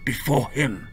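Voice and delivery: slow, hushed, menacing voice; a creepy man, coolly disapproving; sinister calm man. A narrator's reading. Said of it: "the man tisked".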